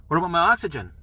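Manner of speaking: loud, pressing question; urgent demand. Retold or imitated. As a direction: insist on knowing the oxygen. Demanding from staff.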